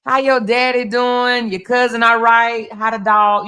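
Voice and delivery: high, bubbly catch-up chatter; chatty neighbour greeting. Retold or imitated. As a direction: gush like you ran into an old friend. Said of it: "You can't be like".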